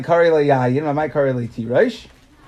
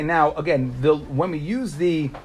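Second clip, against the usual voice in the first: normal talking voice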